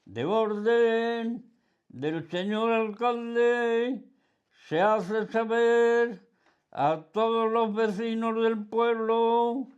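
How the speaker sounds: loud, high, slow proclamation, breaking into laughter at the end; town crier. Retold or imitated. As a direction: bellow it out like a village crier reading an edict. (pregonero a voz en grito, alto y pausado)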